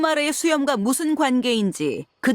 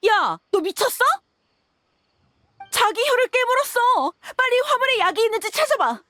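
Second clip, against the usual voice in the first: much higher, panicked outburst; alarmed urgency; 10 semitones higher than usual. Acted. Interrogator finding the captive bit their tongue, alarmed high cry. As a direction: raise the pitch sharply and order help urgently.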